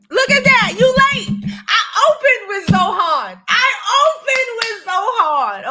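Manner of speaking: loud, high teasing scold; mock scold. Real, not acted. Scolding a viewer who came late, loud and high. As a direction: call out the latecomer, loud and high.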